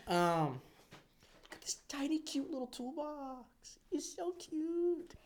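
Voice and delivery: ordinary delivery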